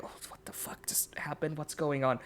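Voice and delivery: hushed whisper among the crowd; low chatter. Acted. Tavern crowd whispers in shock at the news. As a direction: murmur it under your breath. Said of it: "low chatter as".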